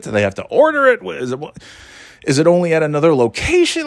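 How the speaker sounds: high, fast exasperated questions; mock exasperation. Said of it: "I'm like".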